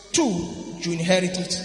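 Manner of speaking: normal talking voice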